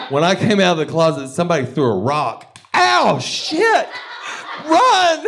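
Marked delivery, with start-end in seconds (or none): 2.7-5.2 very high, loud panicked yelling; mock scream. Acting out fleeing the rock after coming out, high screaming. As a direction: shriek and flee. Retelling rock thrown at him.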